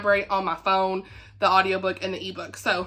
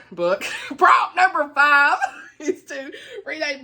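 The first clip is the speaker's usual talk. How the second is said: laughing; 5 semitones higher, 4 dB louder, slower than usual. Real, not acted. Laughs while correcting herself.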